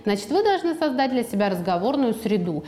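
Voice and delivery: ordinary talk, nothing marked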